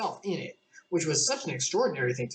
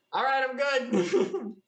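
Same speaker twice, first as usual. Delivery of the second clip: higher, slow, laughing twin; lightly hammed-up, half laughing; a hint of a padded-up brother; twin ready for battle; 10 semitones higher, much slower than usual.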